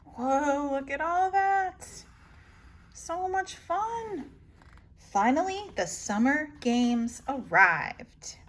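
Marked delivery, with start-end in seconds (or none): 0.0-4.3 high, eager gasp of wonder; excited wonder. Acted. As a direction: gape at everything like a kid. George's excitement voiced.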